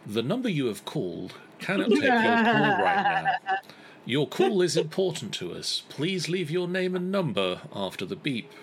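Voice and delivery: automated answering machine message; phone menu voice. Acted. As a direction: read it like a recorded voicemail greeting. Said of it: "you get the magical response along the lines of".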